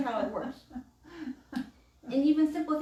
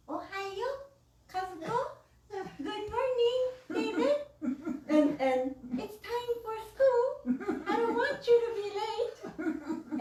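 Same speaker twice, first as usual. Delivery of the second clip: much higher, slow, sing-song gentle mom; sweet wake-up; 10 semitones higher, slower than usual. Retold or imitated. Mom gently waking Kazuko and David, sweet and slow. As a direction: coax the kids awake sweetly. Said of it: "a nice knock on the door".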